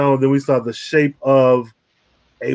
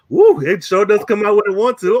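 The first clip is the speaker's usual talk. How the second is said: lightly put-on Sokovian accent, higher and a bit louder; mock Sokovian accent; 7 semitones higher, 4 dB louder than usual. Imitating Agatha mocking Wanda's accent, in a Sokovian lilt. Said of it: "She was like".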